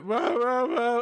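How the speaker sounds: playful mock Wookiee growl; Bigfoot roar. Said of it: "He's talking Bigfoot".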